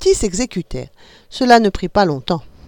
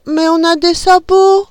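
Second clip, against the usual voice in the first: put-on puzzled kids; 10 semitones higher, 5 dB louder, slower than usual. A narrator's reading. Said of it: "dirent les enfants".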